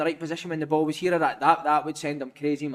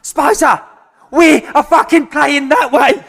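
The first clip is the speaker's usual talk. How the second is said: loud, higher, slow bellowed yell; imitated touchline shout; 7 semitones higher, 12 dB louder, much slower than usual. Martin Allen screaming at Spicer from the touchline, loud bellow. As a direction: bellow it across the pitch. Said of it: "Martin Allen's like".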